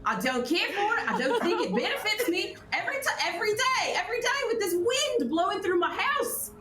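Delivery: put-on high, shrieking old villager yelling at the wind. Villager yelling at the wind from her cottage, high shouting rant.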